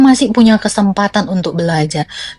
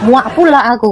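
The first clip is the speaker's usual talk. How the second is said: ordinary delivery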